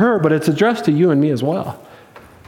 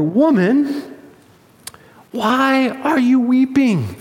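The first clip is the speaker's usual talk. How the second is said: slow, higher-pitched bewilderment; confused angels; 4 semitones higher, slower than usual. Acted. Angels baffled by a weeping Mary at the tomb, confused. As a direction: draw the question out, puzzled and raised. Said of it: "They're confused".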